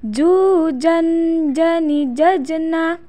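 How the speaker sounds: chanted teaching model; swung, held syllables. Shown as a demonstration. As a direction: stretch and hold, bouncing the closed j. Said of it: "tahan suaranya".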